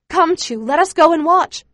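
eager pleading. A narrator's reading. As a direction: beg excitedly, raising the voice high. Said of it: "I plead with her".

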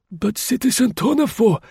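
put-on high, timid old voice; meek old man. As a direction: answer meekly like a frail doorman. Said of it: "replied the concierge meekly".

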